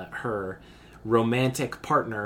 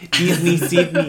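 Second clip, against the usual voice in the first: put-on South African accent; 4 semitones higher, 7 dB louder, slower than usual. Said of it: "This is South African accent".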